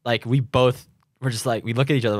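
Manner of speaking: usual speaking voice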